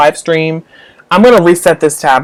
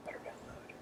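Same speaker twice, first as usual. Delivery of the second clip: "Better download" whispered like a warning; whispering a playful threat to the iPad; 37 dB quieter than usual. Real, not acted. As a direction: hiss a mock threat at the tablet. Repeats it whispered.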